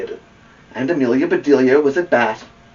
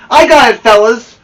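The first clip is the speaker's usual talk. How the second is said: loud, high call to the team; 10 semitones higher, 10 dB louder than usual. Acted. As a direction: shout it proudly across the field. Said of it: "she called".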